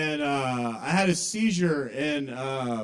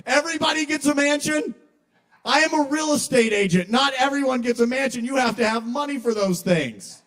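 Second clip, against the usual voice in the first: high, loud incredulous rant; acted outraged shouting; 9 semitones higher, 7 dB louder than usual. Real estate agent protesting Justin's heavenly mansion, shrieking outrage. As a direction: shout it like an entitled snob denied privilege.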